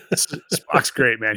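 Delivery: ordinary talk, nothing marked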